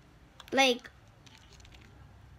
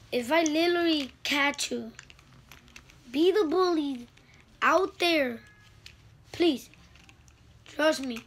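loud, high-pitched scolding; rising into a real angry warning; 4 semitones higher, 4 dB louder than usual. Real, not acted. Kid warning bullies, getting loud and worked up.

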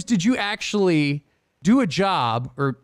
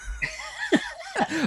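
burst of laughter; 7 dB quieter than usual. Real, not acted. Gun threat punchline.

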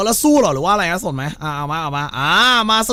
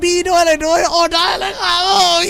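panicked shout; 11 semitones higher, 3 dB louder than usual. Acted. Panicked call for help in game.

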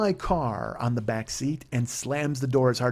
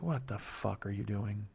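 muttered disbelief; 5 semitones lower, 12 dB quieter, slower than usual. Retold or imitated. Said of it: "I close my eyes like".

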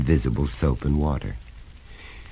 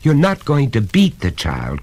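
mildly alarmed, higher voice; raised, worried protest; protesting cry; 9 semitones higher, 4 dB louder than usual. A narrator's reading. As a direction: object on the child's behalf. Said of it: "cried Missus Carter".

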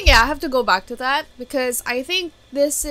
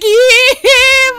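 delighted shrieking laugh; 13 semitones higher, 12 dB louder than usual. Real, not acted. Laughs during the reveal.

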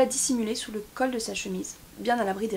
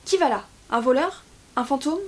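loud, higher challenge in short questions; raised-voice royal challenge; crying out; 4 semitones higher, 6 dB louder than usual. A narrator's reading. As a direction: call out sharply to an unseen intruder. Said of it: "s'écria sans se retourner".

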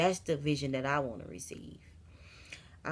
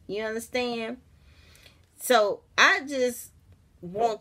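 much higher and louder, thrilled; excited outburst; 6 semitones higher, 8 dB louder than usual. Real, not acted. Preacher thrilled at the blessings she wants to share, excited. Excited praise after laugh.

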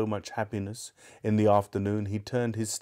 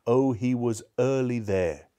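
usual speaking voice

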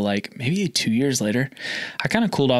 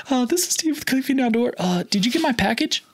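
nervous high voice; 13 semitones higher than usual. Retold or imitated. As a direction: voice shoots up high from nerves on the phone. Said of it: "my voice got, like, so high".

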